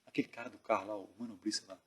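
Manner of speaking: retold in a very soft undertone; hushed question. Sanches quietly asks about the man by the car, hushed. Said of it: "falou baixo".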